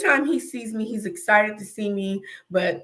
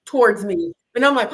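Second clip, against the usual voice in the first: usual speaking voice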